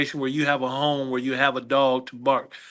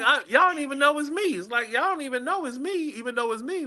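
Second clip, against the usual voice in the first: amused disbelief; 12 semitones higher than usual. Retold or imitated. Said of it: "I'm like".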